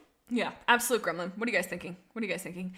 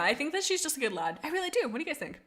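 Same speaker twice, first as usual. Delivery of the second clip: ordinary talk, nothing marked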